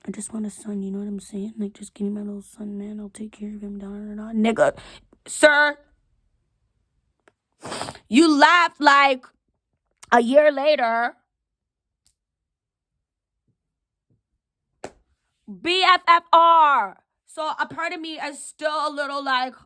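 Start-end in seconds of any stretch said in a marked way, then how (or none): 0.0-4.3 soft whispered mock plea; mocking husband's plea. To her late husband, whispered mocking imitation of his plea. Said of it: "You said". 4.3-17.0 indignant rant. Real, not acted. Scolding late husband angrily.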